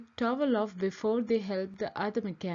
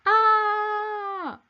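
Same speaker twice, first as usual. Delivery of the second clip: high, loud cry while flying through the air; startled yell; 11 semitones higher, 6 dB louder than usual. A narrator's reading. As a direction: let out a sharp cry of surprise. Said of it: "yelled Eric".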